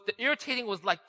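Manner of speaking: ordinary talk, nothing marked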